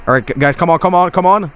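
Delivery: ordinary delivery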